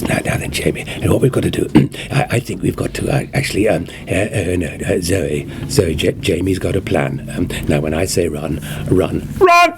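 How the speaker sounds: a fussy, muttering Doctor who bursts out at the end; Patrick Troughton. Shown as a demonstration. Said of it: "your Patrick Troughton impression is uncanny".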